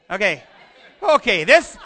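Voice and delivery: sheepish chuckle; laughing. Real, not acted. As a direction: let the laugh spill over the words. Laughing at own slip.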